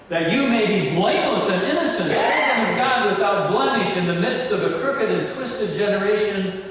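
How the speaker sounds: fervent sermon delivery; preaching. Acted. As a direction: preach it out to the congregation. Preaching at full force.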